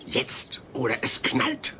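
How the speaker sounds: whispered warning through the teeth; hushed threat. Acted. Hushed threat to hidden Alf.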